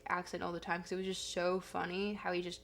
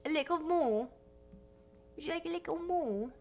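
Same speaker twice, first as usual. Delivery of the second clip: coaxing voice; 6 semitones higher than usual. Acted. Playful offering, higher voice.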